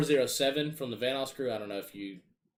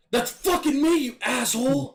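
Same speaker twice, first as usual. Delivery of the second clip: high, loud furious shout imitating Jay; angry yell; 13 semitones higher, 7 dB louder than usual. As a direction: yell it like a teammate who just got shot by his friend. Teammate yelling after being shot.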